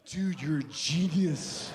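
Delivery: slow, dopey-sounding awed praise; mock awe. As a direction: drag it out as if truly impressed. Mock praise for trivial idea.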